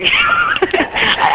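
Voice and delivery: cracking up mid-attempt; high laughter. Real, not acted. Guest starts the ID again, dissolves laughing. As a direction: laugh breaking off into a word. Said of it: "just give me a second".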